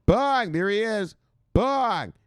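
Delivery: loud, high cheers of bang; triumphant whoop at the photo; cheering at the laser eyes picture, loud and high; excited shout. Real, not acted.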